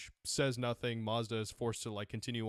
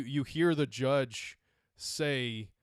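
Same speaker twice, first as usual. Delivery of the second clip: ordinary talk, nothing marked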